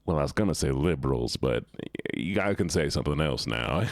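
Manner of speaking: halting after "liberals", then backing off with "but"; retold hesitation before "something else now"; imitated hesitant pause. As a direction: imitate catching yourself, pausing around "but". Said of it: "you could hear him pause there".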